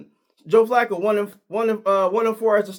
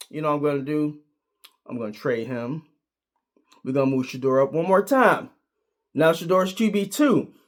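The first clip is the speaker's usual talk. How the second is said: a hint of a calm boss; 5 semitones lower than usual. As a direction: say it like the GM calmly pulling rank. Voicing Andrew Berry.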